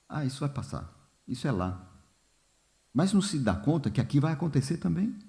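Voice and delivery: ordinary delivery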